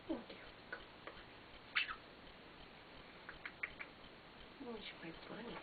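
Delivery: whisper. Real, not acted. Whispered cooing to pet.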